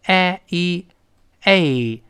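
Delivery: a, e, a stretched very slowly; diphthong demo. Shown as a demonstration. Teacher drawing out the diphthong in plain. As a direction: glide slowly from a to e. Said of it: "发双元音".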